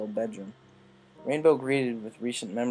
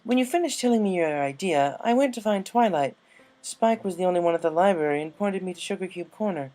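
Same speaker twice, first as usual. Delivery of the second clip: higher, refined mare explaining; Rarity, lady-like; 8 semitones higher, 6 dB louder than usual. A narrator's reading. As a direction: speak up brightly as the prim unicorn. Narrator voices Rarity.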